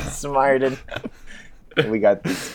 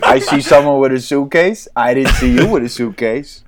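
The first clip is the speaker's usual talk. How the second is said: louder tough-guy gangster voice; tough guy chimp; 4 semitones higher, 6 dB louder than usual. Retold or imitated. Papa chimp brushing off the pig, louder tough-guy voice. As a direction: play the swaggering mob boss. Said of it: "papa fucking chimpanzee is like".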